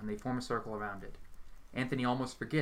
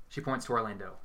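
usual speaking voice

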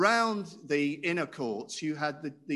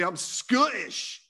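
put-on slow, emphatic Scottish accent, a touch louder; 3 semitones higher, 4 dB louder, slower than usual. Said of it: "Was that a reasonable Scottish accent?".

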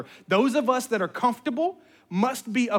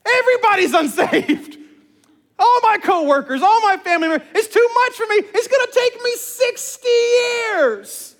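put-on high, loud childlike wail; overwhelmed mock tantrum; 7 dB louder than usual. Preacher mimicking overwhelmed believers like his daughter, wailing mock tantrum. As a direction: wail it like a kid throwing a fit, hammed-up. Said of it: "We get overwhelmed".

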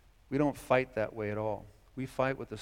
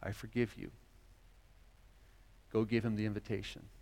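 gentle God; 4 semitones lower than usual. Acted. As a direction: correct him tenderly. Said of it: "God says".

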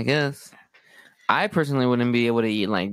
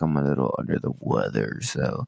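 much lower mock sick voice; imitating Erica; 7 semitones lower than usual. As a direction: drop low and croak like you're ill. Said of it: "This is Erica".

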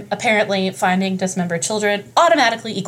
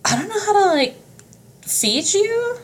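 high-pitched panicky voice; squeaky, flustered hermit; panicked hermit; 5 semitones higher, slower than usual. Retold or imitated. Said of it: "And he was like".

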